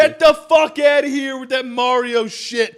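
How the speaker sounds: high, shouted mock outrage; very loud. Real, not acted. Italian podcaster rejecting the other pronunciation of Mario, very loud. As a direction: holler it in big offended protest. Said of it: "I'm very loud".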